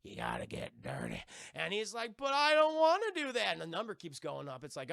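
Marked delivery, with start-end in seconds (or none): 2.2-3.5 higher, louder mock whine of refusal; reluctant protest. Character balking at what he is told, mock reluctant protest. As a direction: protest it loudly with a raised pitch.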